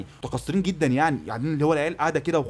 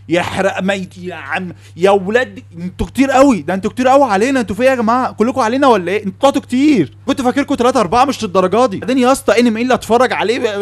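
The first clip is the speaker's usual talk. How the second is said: loud, higher, exasperated outcry; exasperated rant; 4 semitones higher, 7 dB louder than usual. Real, not acted. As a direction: rail at the crowd in disbelief. يصرخ مستنكرًا كثرة محبي الانمي. (صراخ مستنكر عالي)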